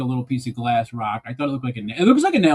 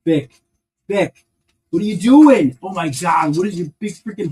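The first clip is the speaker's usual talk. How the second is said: calling out, scolding; 4 semitones higher, 7 dB louder than usual. Real, not acted. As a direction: call Vic, then a loud high What are you doing. Scolding Vic who pushes bin.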